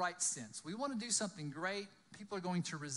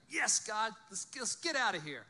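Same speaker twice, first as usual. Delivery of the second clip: excited cheering; 5 semitones higher, 6 dB louder than usual. Acted. Imagined Moses cheering God on.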